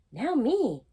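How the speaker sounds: can't wait another second; eager. Acted. Little Mouse eager turn.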